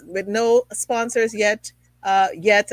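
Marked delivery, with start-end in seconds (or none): 2.3-2.7 "yet" repeated with a firm, louder punch; stress on yet. Real, not acted. Host hinting at sponsors to come, "yet" hit with emphasis. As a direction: lean hard on "yet" as a promise. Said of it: "I emphasize the word yet".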